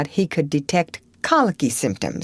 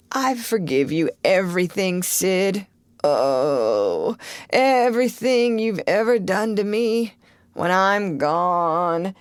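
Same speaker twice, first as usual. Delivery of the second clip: hammed-up dying farewell with a sob; mock deathbed. Tom pretending to die before Sid, mock tearful. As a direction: play the martyr on his deathbed.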